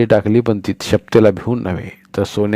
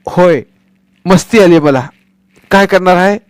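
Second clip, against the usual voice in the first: defiant shout; 9 semitones higher, 7 dB louder than usual. Acted. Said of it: "तो पुन्हा ओरडला".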